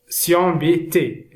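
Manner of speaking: usual speaking voice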